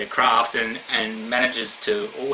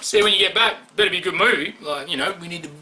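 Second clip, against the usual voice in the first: hammed-up easygoing boyfriend; 7 semitones higher than usual. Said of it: "I'd be like".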